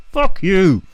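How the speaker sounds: high, loud defiant burst; mock snap. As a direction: spit it back at the police. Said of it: "just go".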